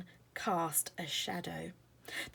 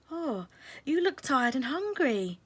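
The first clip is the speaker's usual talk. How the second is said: higher, caring fairy voice; 7 semitones higher, 6 dB louder than usual. Acted. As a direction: voice a kind little fairy.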